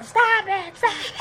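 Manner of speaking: high, hammed-up "stop it" cry; mock dramatic actress. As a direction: parody her overacted shriek. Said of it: "the most acting she's done".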